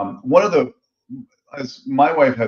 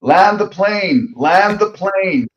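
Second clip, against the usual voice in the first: higher, slow drawn-out plea to wrap it up; impatient; 9 semitones higher, 4 dB louder, slower than usual. Retold or imitated. Husband urging his wife to finish the story, impatient and drawn out. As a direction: hurry them to the point, exasperated. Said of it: "a guy like me who's like".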